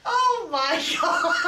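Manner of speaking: loud, high, slow laughing outburst; real laughing shout. Real, not acted. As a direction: burst out laughing in disbelief. Laughing disbelief at tapeworm story.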